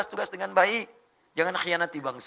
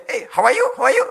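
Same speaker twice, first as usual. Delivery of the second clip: high, loud myna bird greeting; talking myna bird; 10 semitones higher, 11 dB louder than usual. Acted. Myna bird greeting someone it meets, high and loud. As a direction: imitate a bird trained to speak English. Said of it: "Beo kita bisa bahasa Inggris".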